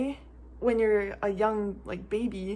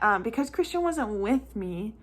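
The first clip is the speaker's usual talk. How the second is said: ordinary talk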